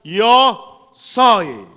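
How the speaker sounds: loud, weighty proclamation; spoken in capital letters. Acted. God naming himself to Moses, loud capital-letter declaration. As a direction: boom each word as if in capitals. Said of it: "está en letras mayúsculas".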